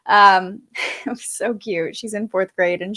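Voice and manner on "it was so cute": ordinary delivery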